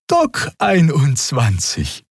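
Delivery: amiable Xia. A narrator's reading. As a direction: charm the guard. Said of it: "antwortete Xia liebenswürdig".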